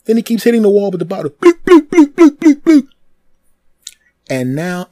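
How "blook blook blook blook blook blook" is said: rapid run of high, loud hollow plonks; repeated blooks. Retold or imitated. Imitating the bottle banging the wall over and over, loud, high plonks. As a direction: keep smacking the bottle, plonk after plonk.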